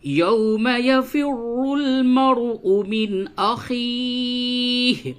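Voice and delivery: high, slow chanted recitation; Quran recitation. Acted. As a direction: lift the voice and chant slowly.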